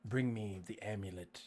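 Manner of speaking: low, soft, ominous command; mimicked mysterious voice. Mimicking the voice he heard, low quiet menace. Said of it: "did it sound like, um, like this?".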